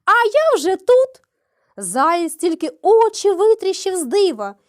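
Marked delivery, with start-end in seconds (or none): 0.1-1.1 a triumphant high shout, louder; hedgehog's wife shouting at the hare. A narrator's reading. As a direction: call out smugly. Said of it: "і кричить".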